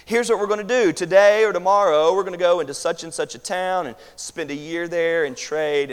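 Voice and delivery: loud, high-pitched mock planner; confident planners. Preacher voices people planning a year of trade, loud and confident. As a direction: announce the plans loudly, as if certain. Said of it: "who talk like this".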